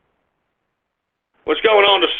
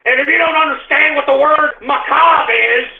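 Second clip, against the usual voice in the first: angry yelling; 5 semitones higher than usual. Real, not acted. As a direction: berate the chat at full volume. Yelling insults at chat.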